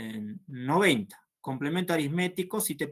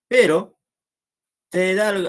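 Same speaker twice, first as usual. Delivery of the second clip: sudden emphatic pero; 5 semitones higher, 11 dB louder than usual. Real, not acted. Teacher catches a missed detail.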